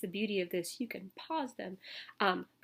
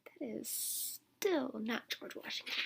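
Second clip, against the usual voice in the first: usual speaking voice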